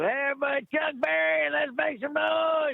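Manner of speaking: high, loud stage-host hype, slowing at the end; rally the crowd like an emcee; emcee shout. Retold or imitated. Hosting stage announcement.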